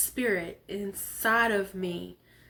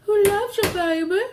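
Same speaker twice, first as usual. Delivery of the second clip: playful tease; 11 semitones higher, 6 dB louder than usual. Pretend. High loud playful.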